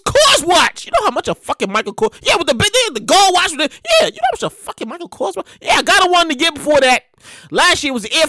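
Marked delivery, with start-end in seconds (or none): none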